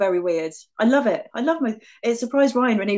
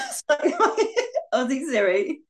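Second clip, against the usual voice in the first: slow, higher, giggled words; laughing; 5 semitones higher than usual. Real, not acted. Friend stuck with her Aussie Siri, laughing. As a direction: laugh your way through it.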